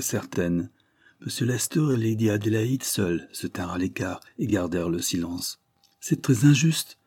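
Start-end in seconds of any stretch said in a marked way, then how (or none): 6.0-7.0 high, louder protest; lively outcry. A narrator's reading. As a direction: burst out in indignation. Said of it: "s'écria vivement Maria". (s'écrier vivement, plus aigu et plus fort)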